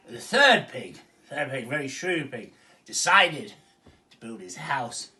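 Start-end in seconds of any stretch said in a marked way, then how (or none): none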